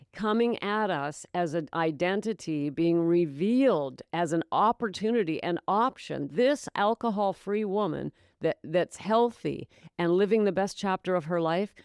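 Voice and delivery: ordinary delivery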